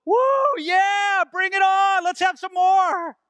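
high, loud daredevil whoop; mock thrilled cheer. Preacher mocking a strange response to an earthquake, whooping with glee. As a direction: cheer wildly, egging it on. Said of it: "wouldn't it be a strange response".

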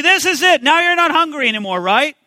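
religious showman. Acted. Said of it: "there's religious showmen out".

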